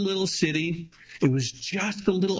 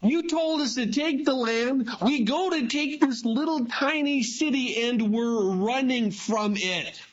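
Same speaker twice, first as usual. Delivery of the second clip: higher, pleading cry to God; Joshua crying out; 7 semitones higher than usual. Retold or imitated. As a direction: plead up to the Lord, voice lifted high. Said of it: "Joshua begins to cry out to the Lord".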